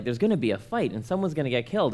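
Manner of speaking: usual speaking voice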